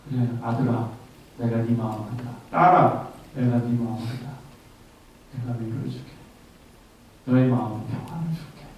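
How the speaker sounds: put-on low, soft and gentle, like God consoling a child; tender fatherly comfort. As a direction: hush down and comfort the listener. God voiced comforting the believer.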